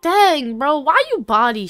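loud, high burst of disbelief; shocked outburst. Real, not acted. Player scolding a character for body shaming, shocked outburst. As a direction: call out the rude remark, voice jumping up. Mock outrage at a game character's remark.

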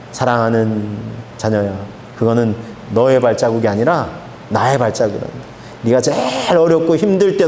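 낮고 부드럽게, 다정한 주님 목소리; 지친 자녀를 달래듯 낮게; tender Lord's voice. Acted. Lord answering gently. (주님이 자녀에게 다정하게 낮은 목소리로)

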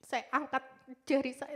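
usual speaking voice

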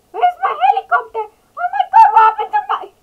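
high, loud, rushed shriek; frantic panic. Real, not acted. Player who cannot find the helicopter, frantic screaming. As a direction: shriek it out fast in alarm. Helicopter missing during attack.

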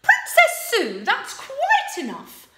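high, loud telling-off; hammed-up huffy prince; scolding prince. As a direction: scold her sharply.

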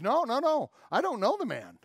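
acted denial, quick high-pitched no's; insistent denial. Peter brushing off an accuser by the fire, acted insistent denial. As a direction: deny it hastily, pitch jumping up then settling. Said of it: "pulling further and further away".

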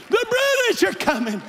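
very high, loud warning cry; Paul Revere. Pretend. Preacher acting out a ride through town, hollering. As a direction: shout the alarm at full force. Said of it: "Paul Revere".

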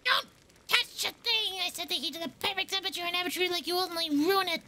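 put-on high, fussy young cook voice; high-pitched cook girl.

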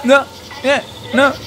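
high panicked no no no; raging gamer. Real, not acted. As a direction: panic as the game goes wrong. Panicked denial.

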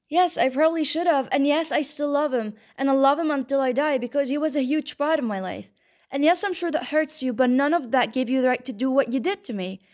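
rising anger. Acted. Wife confronts husband about his abuse.

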